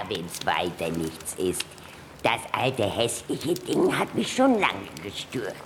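ordinary talk

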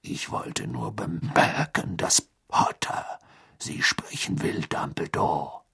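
soft, slow, whispered rasp. Acted. Moody's rasping voice continues.

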